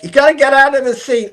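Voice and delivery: mock frail old woman telling them off. Deaf old woman interrupting shoot.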